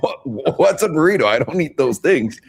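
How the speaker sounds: high, baffled retelling of his younger self; mock bewildered. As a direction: play it as a kid hearing a strange word for the first time. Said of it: "I'm like".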